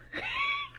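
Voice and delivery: a quick high-pitched giggle. Real, not acted. Laughing at own stumble.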